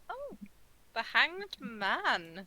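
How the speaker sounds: slow, drawn-out dramatic reveal; ominous reveal. Acted. Tarot reader unveiling a card to the nervous guy, slow and ominous. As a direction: savour the card's name slowly.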